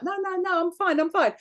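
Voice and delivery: mock high protest. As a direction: brush it off like an embarrassed teen. [name] voiced protesting.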